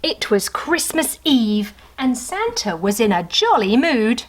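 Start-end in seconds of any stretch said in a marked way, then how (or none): none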